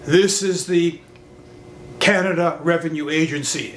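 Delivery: imitating a deep, stern agency caller; deep official voice. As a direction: put on a low official phone voice. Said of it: "exactly in that deep way".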